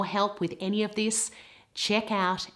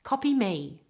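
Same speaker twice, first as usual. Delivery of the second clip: ordinary talk, nothing marked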